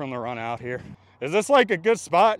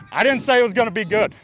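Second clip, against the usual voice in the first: loud protest; 10 semitones higher, 7 dB louder, faster than usual. Real, not acted. Repeats the protest.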